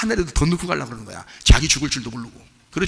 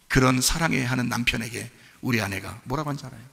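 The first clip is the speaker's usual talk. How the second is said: usual speaking voice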